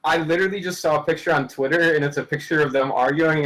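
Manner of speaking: ordinary delivery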